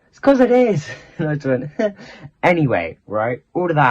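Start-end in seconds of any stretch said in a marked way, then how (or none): 0.1-0.9 teasing reply. Retold or imitated. Someone answers her fear, retold.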